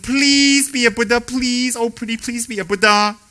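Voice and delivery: high, loud begging voice, pleading with students; pleading guru. Retold or imitated. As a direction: beg them like a desperate guru.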